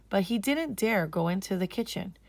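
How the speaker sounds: ordinary delivery, nothing marked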